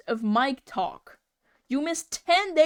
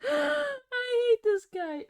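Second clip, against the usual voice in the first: laughing; 14 semitones higher, much slower than usual. Real, not acted. Laughing at a drawing.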